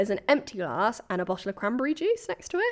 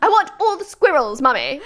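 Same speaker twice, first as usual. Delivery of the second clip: high, loud, demanding posh brat; hammed-up posh little girl making demands; posh child; 6 semitones higher, 6 dB louder than usual. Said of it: "say it in a posh way".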